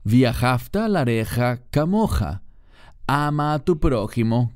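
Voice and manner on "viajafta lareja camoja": "Viajafta lareja camoja" recited as Hebrew, in a set-apart reading voice; Hebrew recitation. Shown as a demonstration. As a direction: give "Viajafta lareja camoja" its Hebrew sounds, a touch formal. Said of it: "La Torá".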